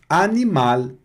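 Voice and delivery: animal said the French way, nasal-free a-ni-mal; French pronunciation. Shown as a demonstration. Teacher demonstrating animal in French. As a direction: say animal as in French, oral vowels. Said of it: "claro que não fica animal".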